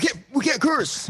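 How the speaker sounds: high-pitched mimicking protest; squeaky mock objection to swearing; mock scolding friend. Dad imitates cohost objecting to cursing, high mocking voice. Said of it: "Brian's like".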